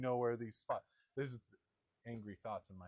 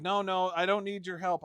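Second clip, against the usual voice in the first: imitating wife, louder and higher, brushing off help; mock defiant refusal; 5 semitones higher, 8 dB louder than usual. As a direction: push away the offer with mock pride.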